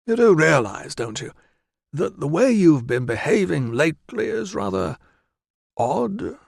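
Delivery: chummy, teasing concern; Valens voice. Acted. Doctor gently humoring his deluded friend, cheery.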